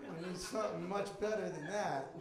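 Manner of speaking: normal talking voice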